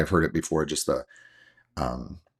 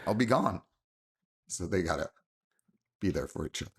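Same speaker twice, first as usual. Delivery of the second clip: choked up; 10 dB quieter, faster than usual. Real, not acted. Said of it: "I can see how that gets you teared up".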